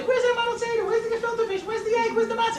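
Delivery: put-on demanding kids. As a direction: pile on the questions like impatient kids.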